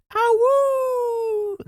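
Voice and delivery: high howling awoo; wolf voice. Pretend. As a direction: howl it like a werewolf. Pretending to be a werewolf.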